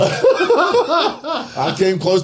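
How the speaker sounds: laughing, slow and high. Real, not acted. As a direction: repeat it while cracking up.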